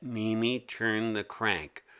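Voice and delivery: plain reading voice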